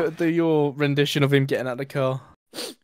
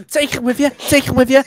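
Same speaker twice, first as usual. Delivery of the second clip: higher, louder jeering Scouse voice; 10 semitones higher, 7 dB louder than usual. Acted. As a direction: jeer after him in Scouse. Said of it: "Go on, [name]".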